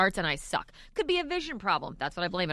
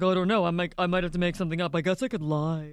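impression of Tim; 5 semitones lower than usual. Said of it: "And he's like".

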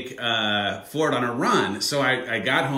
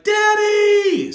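put-on high, loud little-girl squeal; toddler squealing Daddy; 7 dB louder than usual. Toddler greets her dad with a high, loud squeal. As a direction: shriek it like a thrilled toddler. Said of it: "So she sees me and is like".

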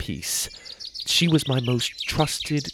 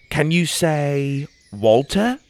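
slow coaxing question; 4 semitones higher, slower than usual. A narrator's reading. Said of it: "I asked her".

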